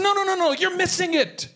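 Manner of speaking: very high-pitched protest; urgent objection. Retold or imitated. Retold challenge to a wrong answer.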